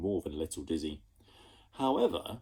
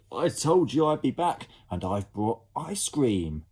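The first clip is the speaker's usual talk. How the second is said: loud cheerful announcement; proud call to the children; a boy calling from his airship; 5 semitones higher, 6 dB louder than usual. Acted. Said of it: "called out Ned".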